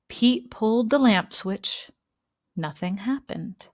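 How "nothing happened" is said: plain reading voice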